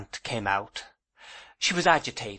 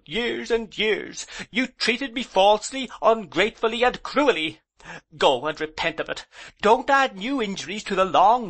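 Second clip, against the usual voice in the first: high, louder, tearful indignation; indignant tears; 9 semitones higher, 4 dB louder than usual. A narrator's reading. Aunt accusing her husband of ruining her, tearfully indignant. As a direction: accuse him through rising tears. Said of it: "my aunt's indignant tears".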